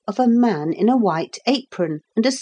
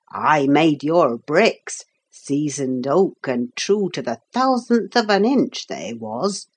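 put-on proud old carpenter; 4 semitones lower than usual. Carpenter telling how he got here, proud and genial. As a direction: explain with a craftsman's pride, mostly pitched down. Carpenter figure explains himself.

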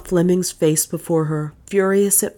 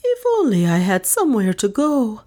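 high, woeful wail; high-pitched forlorn moan; wailing lost soul; 6 semitones higher than usual. A narrator's reading. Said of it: "she wailed in the voice of a lost soul".

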